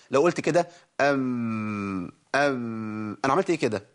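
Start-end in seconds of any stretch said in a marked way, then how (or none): 1.0-3.2 أم, with the lips loosened so the ب turns into a م sound; slack lips demo. Shown as a demonstration. The sheikh shows how loose lips turn ب into م. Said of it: "لو ترخيت في اطباق الشفتين".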